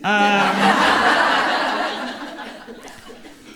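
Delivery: audience laughing; laughter rippling through the room; crowd laughter. Real, not acted.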